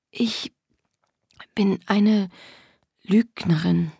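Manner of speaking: halting, faint, broken by pauses; cowed confession. Acted. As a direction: force it out timidly, word by word. Eingeschüchtertes Geständnis. (eingeschüchtert, leise stockend)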